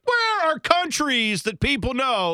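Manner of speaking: high, slow, dopey mock American asking; lightly mocking a clueless American. Mocking clueless Americans, a high slow dopey voice. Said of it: "Americans go like".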